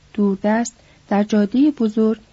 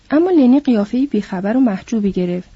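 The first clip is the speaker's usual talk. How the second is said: usual speaking voice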